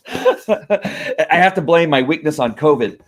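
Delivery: slow words through real laughter; laughing. Real, not acted. Laughing to viewers about being weak from covid, slowly. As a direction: laugh it off while confessing. Laughing at his own weakness.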